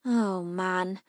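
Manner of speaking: usual speaking voice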